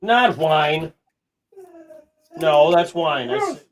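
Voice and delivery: insistent trainer's rebuke; firm correction. Real, not acted. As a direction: correct the dog loudly. Correcting the dog's whining.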